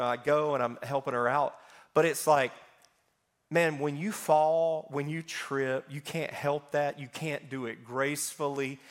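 normal talking voice

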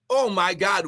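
ordinary delivery

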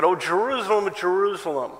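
a touch of a lamenting prophet. Acted. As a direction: grieve over the city. Jesus lamenting over Jerusalem.

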